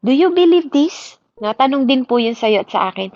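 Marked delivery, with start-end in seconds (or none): 0.1-1.2 high, pointed question to the congregation; lifted challenging question; rising challenge. Acted. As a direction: ask it up high, pressing them. Preacher asks congregation directly.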